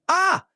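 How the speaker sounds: sudden high yelp of fright; startled scream. Acted. Mock shriek at a ghost popping out of a cookie.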